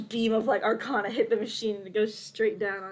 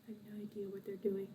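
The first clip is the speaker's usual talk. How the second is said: very soft, under-the-breath admission; muttered bewilderment; 16 dB quieter, faster than usual. Acted. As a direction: say it almost to yourself, hushed. Character staring, muttering to self.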